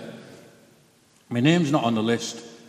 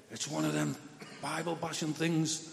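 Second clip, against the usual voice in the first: inner thought; 4 semitones higher, 12 dB quieter than usual. Retold or imitated. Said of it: "in my head".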